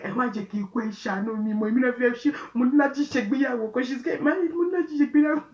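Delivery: pleading that builds to high, loud wailing; rising desperate plea. Retold or imitated. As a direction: beg harder and harder for your life. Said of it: "Bella was like".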